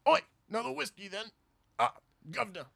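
put-on botched Irish accent full of grunts; Irish drinker reduced to noises; bad Irishman. [name] auditioning as Bad Irishman, botched Irish accent and grunts. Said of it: "Bad Irishman".

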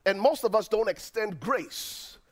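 normal talking voice

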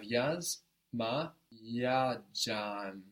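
yaz, ma, ya, jam, each part separate; spaced-out teaching demo of yaz, ma, ya, jam; syllable by syllable. Shown as a demonstration. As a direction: break it into yaz - ma - ya - jam, keeping both A's. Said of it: "we need to keep both".

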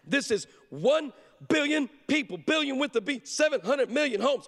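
high, punched word by word; urgent dad drilling it in; emphatic coaching. Retold or imitated. Dad's final urgent coaching before broadcast.

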